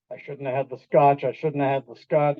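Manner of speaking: low, worried repeating to oneself; a driver's guilty panic, voiced low; nervous self-reproach. Driver seeing police lights in the mirror, low nervous repeating. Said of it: "You see that in the rearview mirror".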